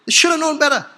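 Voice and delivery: high-pitched, exasperated reproach. Real, not acted. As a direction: throw it out high, at the end of your patience. Same reproach repeated at the climax.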